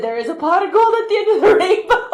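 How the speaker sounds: laughing high and loud, quick. Real, not acted. Medium passing on the father's joke to his son, laughing. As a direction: giggle out the line as you relay it.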